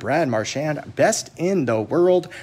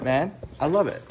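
ordinary talk

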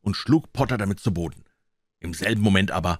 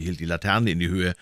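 ordinary delivery

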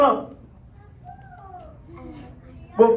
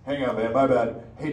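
normal talking voice

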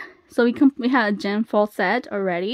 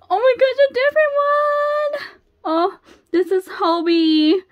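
high, loud squeal of surprise; squeal, then stretch out the name in delight; thrilled squeal; 14 semitones higher, 6 dB louder than usual. Real, not acted. Fan announces Hobie to viewers, thrilled high squeal.

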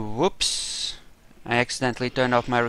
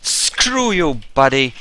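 shouted taunt; 7 dB louder, slower than usual. Real, not acted. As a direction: yell it at the guy you're fighting. Yelling at enemy in fight.

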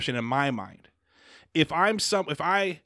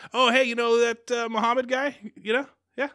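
a hint of a chirpy alien, pitched up; acted alien; 8 semitones higher than usual. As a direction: lightly act it out in a higher voice. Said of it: "they're gonna be like".

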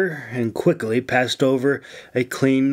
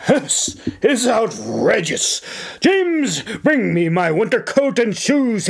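outraged commanding governor; 8 semitones higher than usual. A narrator's reading. Governor ordering James to fetch his coat, outraged. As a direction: bark orders at the butler. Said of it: "he commanded".

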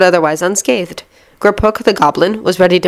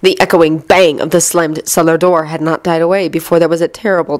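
ordinary delivery, nothing marked

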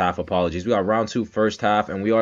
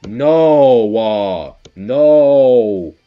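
emphatic shouted refusal; 3 semitones higher, 12 dB louder, much slower than usual. Real, not acted. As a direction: shout down the bad advice. Rebuts people defending Priestess pick.

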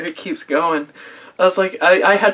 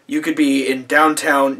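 normal talking voice

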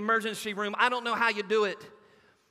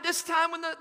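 ordinary talk, nothing marked

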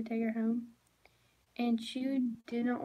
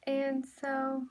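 normal talking voice

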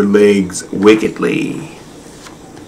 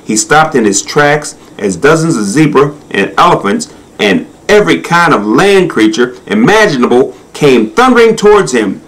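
ordinary delivery, nothing marked

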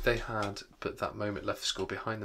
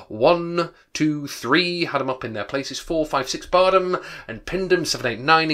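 excited counting; 5 semitones higher, 8 dB louder than usual. A narrator's reading. Counting the lively race.

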